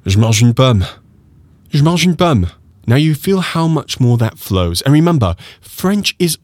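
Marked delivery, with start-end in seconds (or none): 0.1-2.5 "Je mange une pomme" flowing with the e dropped; flowing j'mange. Shown as a demonstration. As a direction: model it smoothly for repetition. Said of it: "repeat after me".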